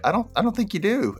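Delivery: skeptical retort. Retold or imitated. New guy doubting Slater's sympathy, skeptical. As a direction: push the doubt up high.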